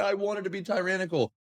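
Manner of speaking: high, mock tearful child voice; whining kid. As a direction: keep the child's wail going into words. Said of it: "even if the kid's like".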